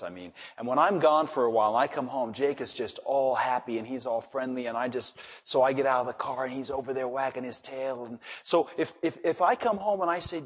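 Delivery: ordinary delivery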